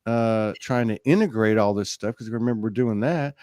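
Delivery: usual speaking voice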